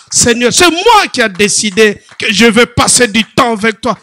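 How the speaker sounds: prayer that builds to loud preaching; fervent prayer. Acted. Preacher demonstrates fasting prayer, building to loud fervent prayer. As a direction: pour it out loud to the Lord.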